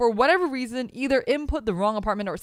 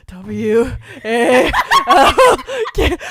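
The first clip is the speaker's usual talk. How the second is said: slow spelling, choking on laughter; laughing spelling; 5 semitones higher, 14 dB louder, much slower than usual. Real, not acted. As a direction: spell each letter while trying not to crack up. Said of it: "spelling Valkyria, trying so hard not to".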